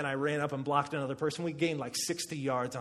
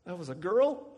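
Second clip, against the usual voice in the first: surprised; 4 semitones higher, 4 dB louder than usual. Retold or imitated. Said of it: "And I'm like".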